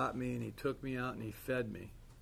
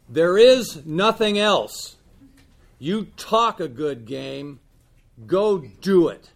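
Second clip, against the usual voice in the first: loud, slow, high yell; 5 semitones higher, 15 dB louder, slower than usual. Retold or imitated. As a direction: lean over him and bark it out slowly. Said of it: "he rarely yells at me".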